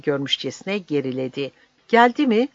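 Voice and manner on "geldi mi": high, loud shocked cry falling back. A narrator's reading. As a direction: gasp it out in alarm, higher and louder. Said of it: "diye haykırdı".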